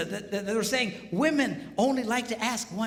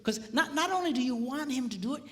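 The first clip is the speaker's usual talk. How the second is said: normal talking voice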